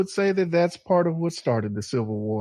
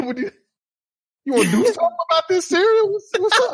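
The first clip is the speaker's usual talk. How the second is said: much higher, fast, hammed-up threat; mock tough challenge; faster than usual. Joking with friends, hammed-up squaring up over cereal. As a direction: puff up like starting a fight over cereal.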